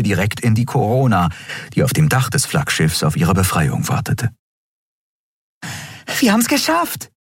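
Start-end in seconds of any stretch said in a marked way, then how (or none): 6.2-7.1 very high, delighted cry; joyful cheer. A narrator's reading. Nelen cheering with joy in the corridor. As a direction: cheer like you just escaped. Vor Freude einen Looping.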